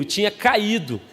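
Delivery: emphatic preacher call; preaching. Acted. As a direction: proclaim it. Preacher repeats with congregation.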